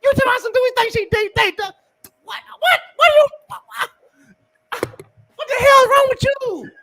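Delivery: yelling in outrage, pitch way up; furious shout. Real, not acted. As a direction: rant at the question in full fury. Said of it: "I didn't mean to yell".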